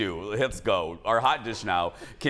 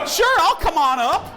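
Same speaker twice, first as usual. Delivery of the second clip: eager abductee voice; 13 semitones higher, 6 dB louder than usual. Retold or imitated. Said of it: "you would be like".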